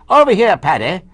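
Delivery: high, loud posh English call; posh English accent. Retold or imitated. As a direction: hail someone in upper-class English. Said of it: "you've got a posh areas".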